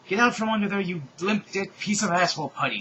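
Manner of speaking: high, loud angry screaming insult. A narrator's reading. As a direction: scream the insult while shooting. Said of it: "screamed Stephanie".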